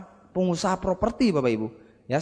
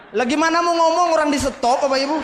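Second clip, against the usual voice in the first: laughing; 8 semitones higher, 4 dB louder than usual. Real, not acted. Laughs telling audience punchline.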